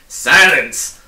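officer silencing the terrified bug; thundering. Acted. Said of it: "thundered the policeman".

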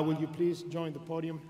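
ordinary delivery

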